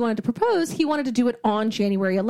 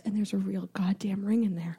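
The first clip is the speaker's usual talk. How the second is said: near-whisper, much softer; hushed reveal; 3 semitones lower, 7 dB quieter than usual. Real, not acted. Storyteller revealing the ring in the box, hushed. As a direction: drop to a whisper for the big reveal.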